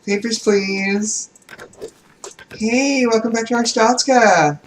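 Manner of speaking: high, sweet fake-friendly clerk; syrupy service voice; customer service voice. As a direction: greet them like a too-cheerful agent. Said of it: "my customer service voice".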